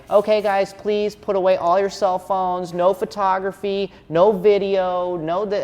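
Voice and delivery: high, loud mock announcement; show host. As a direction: call out house rules like a club host. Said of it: "I hear the host out there".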